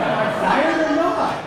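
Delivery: high, loud comic yell; exasperated demand. Retold or imitated. Acting out his exasperated demand.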